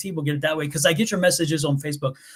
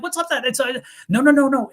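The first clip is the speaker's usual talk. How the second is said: high, baffled mock protest; confused; 6 semitones higher, 3 dB louder than usual. New realtor reacting to the Halloween ban, high and baffled. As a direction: squeak out your disbelief. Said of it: "And I'm like".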